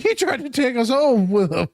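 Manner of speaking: high mock-alarmed accusation; tattling passenger. Passenger tattling on him, retold.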